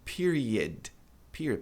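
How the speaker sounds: PERIOD as "PEER-ee-ud"; correct reduced O. Shown as a demonstration. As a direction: let the last vowel of "period" go weak. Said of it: "a tendência é que esse O".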